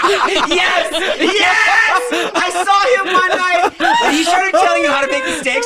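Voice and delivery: high, loud shriek through laughter; helpless laughter, yelling over it; laughing shout. Real, not acted. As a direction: shout it while cracking up. Cracking up over shared story.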